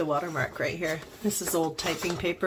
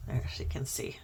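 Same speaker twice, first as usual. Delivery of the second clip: whisper; 5 semitones lower, 7 dB quieter than usual. Real, not acted. Crafting, quiet aside.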